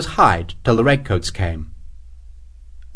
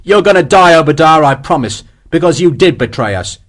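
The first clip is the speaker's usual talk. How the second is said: angry threat; 4 semitones higher, 5 dB louder than usual. Acted. Sharp threatens Hexwell.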